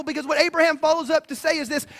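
ordinary talk

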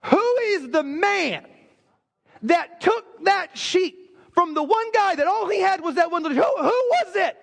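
rising indignation, demanding who did it; furious outburst. Retold or imitated. King hearing the parable, outraged and demanding. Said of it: "David is so furious that he's like".

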